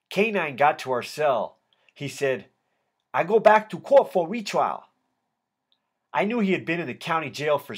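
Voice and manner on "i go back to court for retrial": put-on higher, louder Vietnamese-accented voice. A narrator's reading. K nine telling his friend about his case, Vietnamese accent. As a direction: announce it in a thick Vietnamese accent. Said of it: "in a heavy Vietnamese accent".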